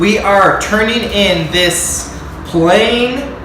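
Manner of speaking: loud, punchy TV-host intro, slow at the start; hyped vlog intro. Acted. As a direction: sell it like a cooking show host.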